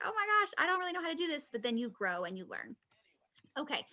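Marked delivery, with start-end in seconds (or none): 0.0-1.5 high, fast flustered rush; a hint of a panicking first-timer; mock flustered beginner. Beginner panicking the first time, fast and high. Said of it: "the first time it's like".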